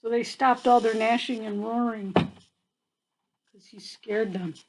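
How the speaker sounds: usual speaking voice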